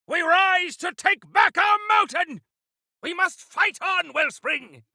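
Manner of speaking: loud, high war cry to the troops; rallying battle cry. Acted. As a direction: rouse the fighters, shout it out.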